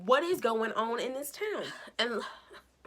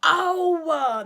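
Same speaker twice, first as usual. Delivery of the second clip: drawn-out oh's, higher and louder; mock disbelief; 6 semitones higher, 7 dB louder, much slower than usual. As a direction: slowly shake your head at it. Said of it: "I said".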